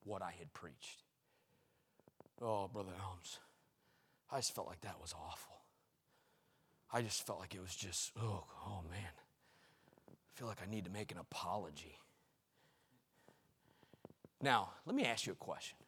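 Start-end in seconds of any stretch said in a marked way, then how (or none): 2.4-12.0 bemoaning. Retold or imitated. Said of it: "I started bemoaning".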